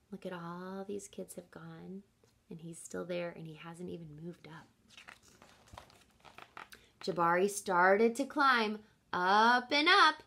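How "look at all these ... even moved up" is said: hushed aside to the class, much softer; quiet teacher aside. Real, not acted. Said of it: "teacher aside, soft".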